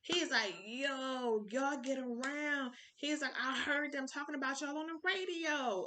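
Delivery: high, drawn-out re-enacted amazement; excited recognition. Star excited to realise who they are.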